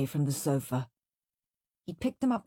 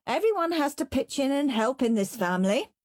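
scolding mum; 7 semitones higher than usual. A narrator's reading. Mum scolding Henry about chores.

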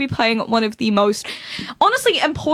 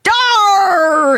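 higher, drawn-out pirate "arr"; 5 semitones higher, 4 dB louder than usual. Acted. Host answering a pirate joke, hammed-up pirate. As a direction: let out a long hammy pirate cry. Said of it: "what's a pirate's favorite letter".

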